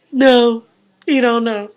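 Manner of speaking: mock reply. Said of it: "answering the friend".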